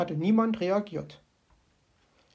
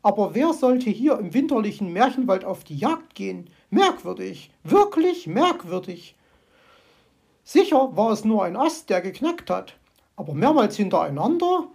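high, louder startled bird voice; startled magpie, louder and excited; 3 semitones higher, 6 dB louder than usual. Acted. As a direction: jump at the noise, then reason nervously. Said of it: "murmelte sie aufgeregt".

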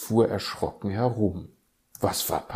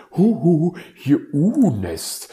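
higher, louder hooting greeting; cheerful owl; 9 semitones higher, 9 dB louder than usual. Acted. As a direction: hoot the hello like a merry owl. Uhu meldet sich am Telefon. (fröhlicher Uhu, höher und lauter)

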